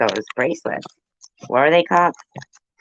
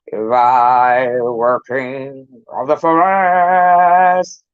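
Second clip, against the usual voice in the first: slow, louder hammed-up Lion delivery; Cowardly Lion; 4 dB louder, much slower than usual. Quoting the Wizard of Oz Lion, slow and hammed-up. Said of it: "remember in Wizard of Oz".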